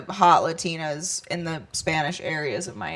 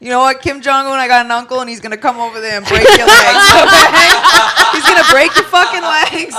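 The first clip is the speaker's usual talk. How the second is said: a louder, laughing Boston tough guy; Boston tough-guy president; 4 semitones higher, 9 dB louder than usual. Pretend. President warns Kim Jong-un, laughing louder Boston tough-guy threat. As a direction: threaten with a grin, Boston style.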